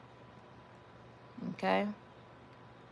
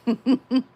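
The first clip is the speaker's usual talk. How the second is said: sudden high loud laugh; embarrassed laugh at falling off her chair live; loud burst of laughter; 7 semitones higher, 11 dB louder than usual. Real, not acted.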